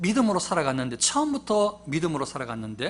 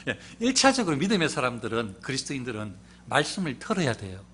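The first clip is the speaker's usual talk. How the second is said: normal talking voice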